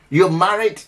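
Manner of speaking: ordinary talk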